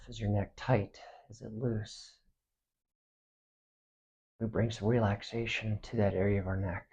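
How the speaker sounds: soft, unhurried meditation voice with long pauses; hushed, spacious relaxation guidance; guided body scan meditation. Acted. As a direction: guide them gently through the body, quietly.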